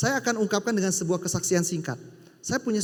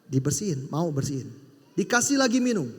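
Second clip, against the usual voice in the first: usual speaking voice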